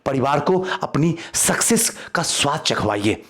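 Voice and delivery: usual speaking voice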